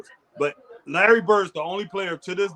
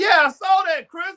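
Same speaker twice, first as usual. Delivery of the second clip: excited cry; 11 semitones higher, 5 dB louder than usual. Real, not acted. Reacting to the dunk.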